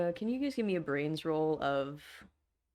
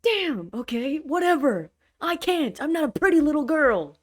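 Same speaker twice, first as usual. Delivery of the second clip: defensive shout; 6 semitones higher, 6 dB louder than usual. Acted. Defensive drug dealer shouting back.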